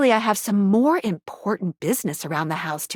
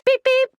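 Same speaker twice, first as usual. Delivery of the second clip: high, even spoken beeps; mock sensor beep; 13 semitones higher, 4 dB louder than usual. Guide announcing a sensor reading, high mock beeping. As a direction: imitate an electronic alert in a high voice.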